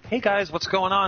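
acted-out, high-pitched bartender greeting; mock friendly greeting. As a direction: pitch it up to greet waiting guests. Greeting annoyed customers in the story.

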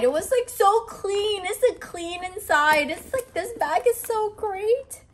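high, teary, rushing squeals; can barely contain it, voice cracking up high; overexcited. Real, not acted. Vlogger overwhelmed by the clean bag interior, teary high squeal. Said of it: "I need to calm down".

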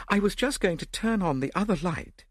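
high-pitched shaken lady's voice; distressed Lady Yardley. A narrator's reading. Lady telling how she was robbed, high distressed voice.

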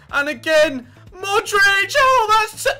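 high, loud cry cut short; excited shout. Real, not acted. Commentator as Modric shoots, excited shout. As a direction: climb into a yell.

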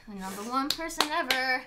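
normal talking voice

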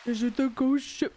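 attempted low man's voice; deep voice. Shown as a demonstration. Streamer answering requests for deep voice, low attempt. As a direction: try dropping low for a deep character voice. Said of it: "everyone is saying deep voice".

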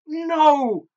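long, high, loud cry; drawn-out high scream of protest; horrified scream. A narrator's reading. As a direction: scream it out in horror. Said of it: "my auntie screamed".

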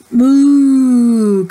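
loud, drawn-out cow moo. Acted. Teacher reading to kids, loud cow moo.

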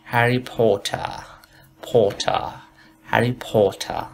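"هری پوتر" as "po-tah", r dropped; slow, rounded o and crisp t in "پوتر"; British "Harry Potter". Shown as a demonstration.

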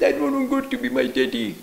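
put-on high, sulky little boy; stubborn child voice. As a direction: play the stubborn kid.

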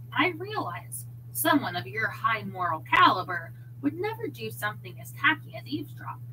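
usual speaking voice

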